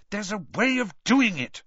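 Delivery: a touch of irritation, refusing defeat; girl crossly insisting to her friends. Acted. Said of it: "Hermione said crossly".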